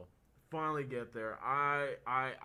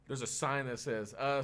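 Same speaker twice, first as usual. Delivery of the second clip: ordinary delivery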